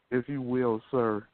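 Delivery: ordinary talk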